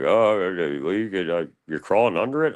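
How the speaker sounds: lightly mock a hesitant, baffled officer; puzzled police officer. Police on the phone questioning his break-in, puzzled.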